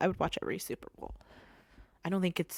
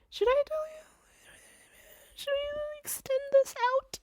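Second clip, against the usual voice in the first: teasing, high-pitched hushed question; playful high whisper. Real, not acted. Podcaster teasing listeners about extending the episode, high playful whisper. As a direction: tempt the listeners with a secret.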